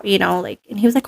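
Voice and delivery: normal talking voice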